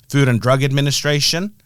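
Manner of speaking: usual speaking voice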